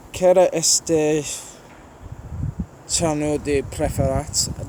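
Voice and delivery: slow, careful try at Romanian with a Birmingham accent; attempted Romanian. Shown as a demonstration. Vlogger reading a viewer's Romanian question aloud, slow careful attempt. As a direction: sound out each Romanian word slowly, not sure of it. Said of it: "that's the best Romanian I can do".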